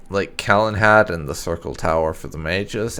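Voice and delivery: normal talking voice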